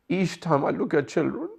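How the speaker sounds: about to break down, pitched high; tearful. Real, not acted. Preacher recounting the Imam's grief over thirsty children, tearful. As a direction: voice rising toward a sob.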